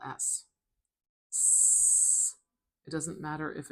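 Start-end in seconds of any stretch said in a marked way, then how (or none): 1.3-2.4 long, high hissing S; "S." as a pure voiceless hiss; sustained S sound. Shown as a demonstration. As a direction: hold "S." as a drawn-out hiss, not the letter name. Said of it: "Really high-pitched sound".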